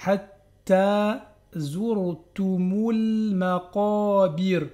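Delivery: chanted Hatta zurtumul maqabir, very slow; drawn-out chanted verse; slow recitation. Shown as a demonstration. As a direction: recite it like a Quran reader. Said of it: "deux temps, prolongation".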